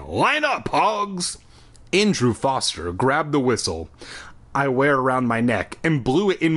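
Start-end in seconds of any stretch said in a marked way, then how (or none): 0.1-1.4 shouting. A narrator's reading. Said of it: "shouted again".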